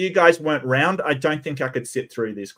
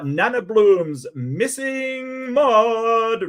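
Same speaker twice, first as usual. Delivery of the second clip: loud, high, slowly stretched ring announcer; wrestling commentator; 6 semitones higher, 3 dB louder, much slower than usual. Acted. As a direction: boom out each name like a wrestling intro. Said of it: "I'm trying to do the wrestling announcer voice".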